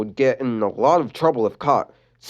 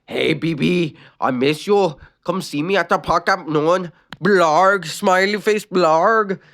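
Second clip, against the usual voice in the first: Adam voice; 6 semitones higher, slower than usual. Acted. Adam's text read in voice.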